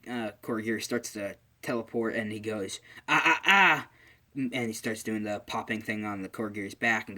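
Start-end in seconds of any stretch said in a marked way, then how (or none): 3.1-3.9 high, loud "not so fast" tut; play-acted scolding stop signal; acted warning. Stops villain trying to teleport.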